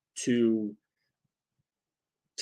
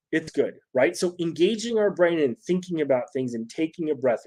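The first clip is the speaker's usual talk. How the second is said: ordinary talk, nothing marked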